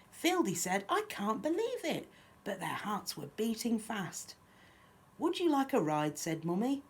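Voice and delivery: lively, lilting picture-book reading; children's story narration. A narrator's reading. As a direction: read a rhyme aloud to little ones. Reads picture book aloud.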